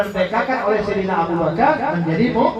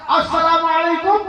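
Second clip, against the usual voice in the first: raised, higher third greeting; somewhat louder salam; 7 semitones higher than usual. A narrator's reading. The Prophet greets the silent house a third time, louder. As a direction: call the salam a bit louder so they hear. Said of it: "Nabi agak keras".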